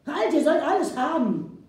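loud, high surrender cry; loud giving-in cry; pleading shout. Acted. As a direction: shout halt, then give in fast. Chief yields, cries out.

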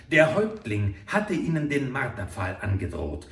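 ordinary delivery, nothing marked

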